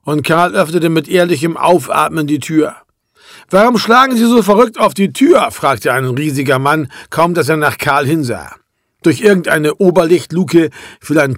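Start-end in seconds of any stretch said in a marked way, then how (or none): 3.5-5.5 mildly indignant question, pitched up; annoyed challenge. A narrator's reading. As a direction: narrator lightly voicing an irritated man. Huge man challenges the boy knocking.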